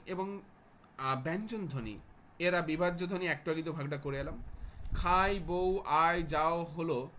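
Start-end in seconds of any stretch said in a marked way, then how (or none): 4.9-6.6 খাই, বউ, আয়, যাও said slowly and loudly with clear glides; slow, loud classroom demonstration; pronunciation demo. Shown as a demonstration. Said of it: "কি অর্ধ স্বরধ্বনি".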